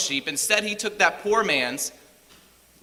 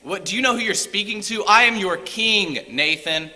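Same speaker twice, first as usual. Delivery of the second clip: angry king; 5 semitones higher, 5 dB louder than usual. Retold or imitated. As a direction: pull rank in indignation. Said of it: "Now I'm angry".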